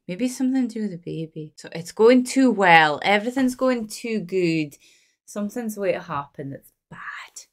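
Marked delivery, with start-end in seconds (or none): 5.2-7.5 hushed whisper. Real, not acted. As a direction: whisper it as if the film might hear. Tense moment watching the film.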